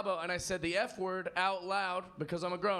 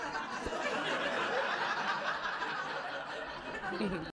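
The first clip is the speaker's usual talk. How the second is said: maniacal laugh. Pretend. Drunk Mortal Kombat finish moment.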